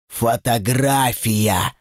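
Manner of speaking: slow, drawn-out demon voice; demonic reminder. A narrator's reading. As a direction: draw the word out like a demon. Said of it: "напомнил демон". (медленный потусторонний голос демона)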